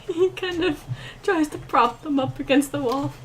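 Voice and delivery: normal talking voice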